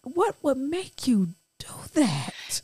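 a husband gently scolding his wife, ever so nicely; sweet gentle scold. Retold or imitated. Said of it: "his yelling to be like".

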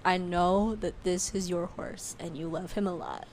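slow, softened tender appeal; mock gentle sincerity. Actor asking owner for horse, gently sincere. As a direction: imitate someone breaking it kindly.

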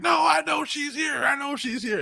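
yelled way up high, unshakable; high shouted insistence. Retold or imitated. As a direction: scream that she's here.

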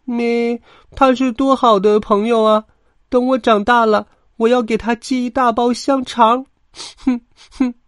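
very high, tearful little lamb voice; sniffling lamb. A narrator's reading. Little lamb mourns a lost friend, sniffling squeal. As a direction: bleat and whimper as a hurt small lamb. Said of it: "抽抽搭搭地说".

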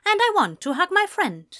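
plain reading voice

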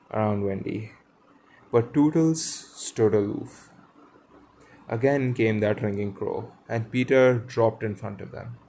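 clear, projected read-aloud with lightly acted dialogue; story narration. A narrator's reading. Reader telling of the boys and the fallen lady, storytelling voice.

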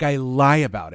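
ordinary delivery

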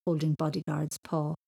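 usual speaking voice

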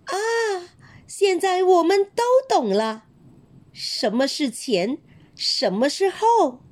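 ah, we finally get it; delighted students. A narrator's reading.

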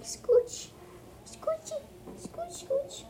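put-on high squeaky baby talk. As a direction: coo it in a tiny voice.

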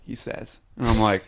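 usual speaking voice

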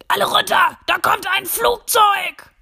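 loud alarmed shout, slowed. Acted. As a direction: yell a warning to duck. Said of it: "plötzlich ruft der Chef". (laut rufend, alarmiert)

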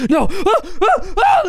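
high agonized death screams; dying scream. Acted. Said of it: "a guy who goes".

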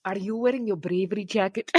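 softly put-on Scottish accent; fake Scottish accent. Shown as a demonstration. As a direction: try out a Scottish catchphrase accent quietly. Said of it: "I have a few Scottish phrases".

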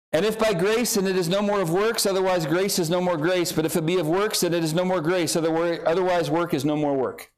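rapid-fire tongue-twister recital; really fast reading. Shown as a demonstration. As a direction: race through the verse without pausing. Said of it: "I'm gonna read it really fast".

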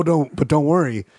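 usual speaking voice

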